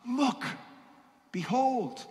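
John the Baptist calling out high; high, ringing proclamation; crying out. A narrator's reading. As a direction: call out Look, behold in awe. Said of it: "and then cried".

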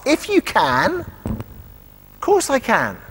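slow, high-pitched indignant echo; quite cross. Retold or imitated. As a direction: throw it back slowly as acted, cross disbelief. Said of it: "Jesus seems quite cross".

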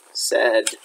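usual speaking voice

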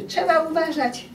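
mildly hammed-up scolding; mock scolding. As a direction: imitate an adult telling a child off. Scolding a child, quoted as what not to say.

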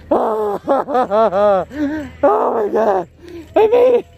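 high elated cries at the finish; overwhelmed joyful shouts; relieved laughter. Real, not acted. Crossing the marathon finish line.

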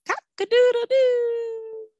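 high crowing line; rooster crow. Shown as a demonstration. Teacher repeating the rooster call for children, high crow.

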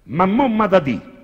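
high, loud, slow Roman accent. Shown as a demonstration. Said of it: "in romano è".

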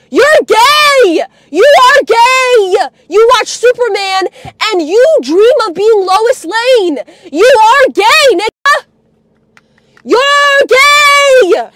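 high, loud, drawn-out taunting yell; furious yelling. Real, not acted. Hurling insults at grandpa, furious yelling. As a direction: yell the insult, stretching the last word.

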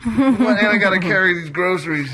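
high, laughing protest; mock indignation. Host playfully voices hurt fellas, mock indignant and laughing. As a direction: squeak out a joking complaint through laughter.

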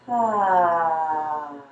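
long falling "ah" on the out-breath; drawn-out relaxation sigh; exhale on "ah". Shown as a demonstration. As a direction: sigh out an open, sustained ah. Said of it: "exhale with the sound of the letter".

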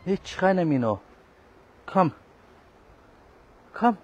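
loud, high telling-off; scolding shout. Real, not acted. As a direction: shout at the cat to stop. Scolding a yowling cat. (laut schimpfend, hoch)

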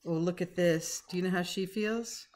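ordinary delivery, nothing marked

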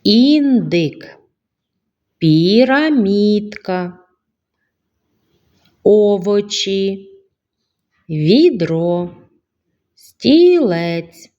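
put-on name each picture slowly for the children; drawn-out naming. Shown as a demonstration. Teacher naming pictures slowly for the children. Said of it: "Визначте звук".

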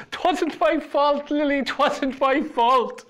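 high, slow squeak; squeaky voice. A narrator's reading. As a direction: squeak the plea in panic. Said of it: "in a voice that squeaked".

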